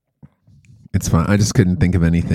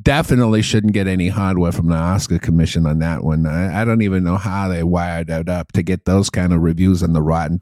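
put-on Boston accent, dropping lower on the dismissals; Boston movie critic; 3 semitones lower than usual. Comic asked to review Pig, Boston movie critic accent. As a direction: pan the film like a Boston critic. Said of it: "Review Pig".

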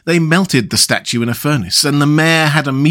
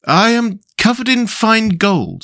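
the prince; 10 semitones higher than usual. A narrator's reading. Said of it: "said the prince".

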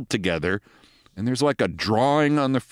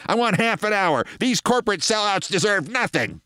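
mock indignant kid; 8 semitones higher than usual. Kid demands more time.

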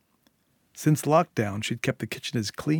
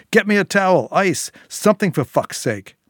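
loud, higher barked orders; angry demand; 8 semitones higher, 7 dB louder than usual. A narrator's reading. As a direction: snap out the demands in pain. Injured man ordering his wife.

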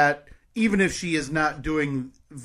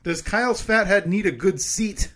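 slow, higher emphatic host read; game show host; 7 semitones higher, 5 dB louder than usual. Acted. Reads poll questions.

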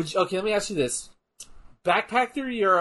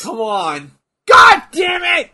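loud plea exploding into a high yell; real exasperated shout; 12 semitones higher, 16 dB louder, slower than usual. Real, not acted. Fan watching a count-out finish, exasperated shout. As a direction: yell in frustration at the screen.

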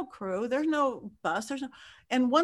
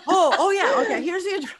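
lightly voiced crew member, higher and louder; high mock crew voice; 8 semitones higher, 6 dB louder than usual. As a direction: play the crew offhandedly giving in. Crew voice giving address.

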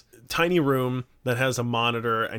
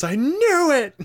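much higher and louder, triumphant; gleeful exclamation; 13 semitones higher, 5 dB louder, slower than usual. Retold or imitated. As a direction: burst out with delighted glee. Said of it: "starts laughing hysterically".

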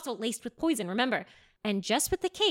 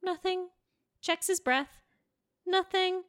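suspenseful; 8 semitones higher than usual. A narrator's reading. Suspense build.

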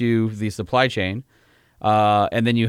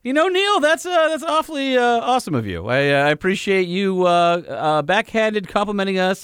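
mock sweet thanks; 9 semitones higher than usual. Host mock-thanking caller's insult.

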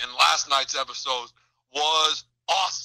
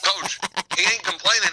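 ordinary talk, nothing marked

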